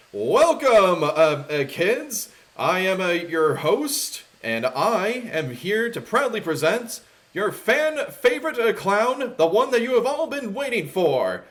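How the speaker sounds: big, high, loud show host; announcer. Acted. Host introducing the clown to a crowd of kids, booming announcer. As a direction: project like a kids' show announcer. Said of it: "you actually hear an announcer going".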